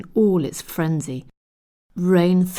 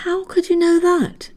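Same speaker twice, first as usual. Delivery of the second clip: much higher, startled exclamation; astonished; 12 semitones higher, 4 dB louder than usual. A narrator's reading. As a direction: blurt it out in disbelief. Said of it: "exclaimed Kirsty in astonishment".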